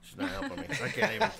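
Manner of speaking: laughing exasperation at getting no help; amused protest. Real, not acted. Joking about spouse's unhelpfulness amid laughter.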